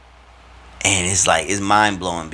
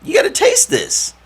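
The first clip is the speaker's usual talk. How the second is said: rising, louder and higher urging; pushy coworker; 10 semitones higher, 6 dB louder than usual. Retold or imitated. Coworker tempting the fasting speaker with food, rising and insistent. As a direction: push the food on him, climbing with each line. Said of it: "He's like".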